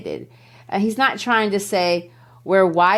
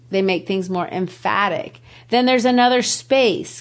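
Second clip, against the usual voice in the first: ordinary talk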